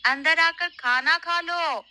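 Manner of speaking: loud, high call across the house. Acted. Mother calls daughter Sona inside to eat, loud high call. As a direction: call her in to eat from far away.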